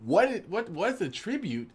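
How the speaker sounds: high, incredulous mock question; confused kid stumped by the rulebook; baffled outburst. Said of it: "First thing I thought was".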